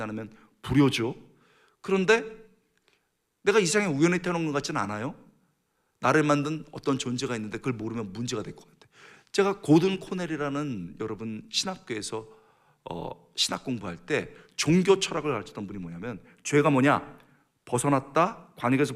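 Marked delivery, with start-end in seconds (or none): none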